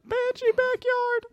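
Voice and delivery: high, slow, wailing ghost cry; haunting voice. Pretend. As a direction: wail it out like a ghost. Echoing the banshee cry.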